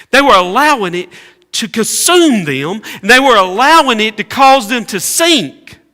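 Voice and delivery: loud, high preaching build; preaching at full force. Acted. Preacher rising to full force before the church. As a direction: drive it home to the congregation.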